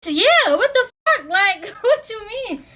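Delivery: high-pitched indignant disbelief; high, exasperated what-the-heck reply; incredulous retort. Retold or imitated. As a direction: fire it back like the question is absurd. Retorting to girl's question.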